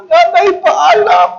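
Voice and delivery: loud, high hammed-up graveside wail; theatrical sobbing cry; mock crying. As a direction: bawl a farewell in exaggerated grief. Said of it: "Umiiyak ka na sa sementeryo".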